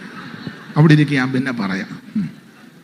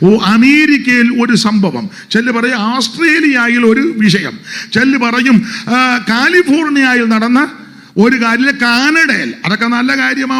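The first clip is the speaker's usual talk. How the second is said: raised, high, loud pulpit delivery; full-force preaching; 8 semitones higher, 8 dB louder than usual. Acted. Pastor preaching hard to the congregation, loud and raised. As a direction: preach it out to the whole hall.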